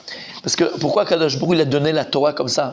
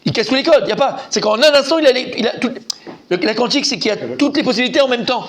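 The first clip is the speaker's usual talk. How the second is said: rushing to explain, carried away; excited; 6 semitones higher, faster than usual. Real, not acted. Teacher explaining quantum computing to students, excitedly. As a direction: voice climbing high with excitement.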